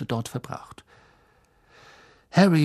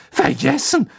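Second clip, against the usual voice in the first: ordinary talk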